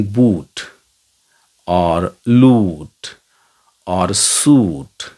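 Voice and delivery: बूट, लूट, सूट with the oo held long and slow; long oo sound. Shown as a demonstration. Teacher demonstrating the long oo, बूट लूट सूट drawn out. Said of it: "इसमें दीर्घ ऊ sound है".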